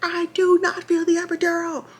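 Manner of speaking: high, strained, insistent cry; retold screaming. Labouring mother tells staff the epidural failed, screaming. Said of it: "Screaming, cursing".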